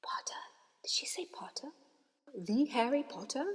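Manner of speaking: soft hushed gossip; whispering crowd. A narrator's reading. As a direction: whisper it to your neighbour. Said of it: "whispers suddenly broke out".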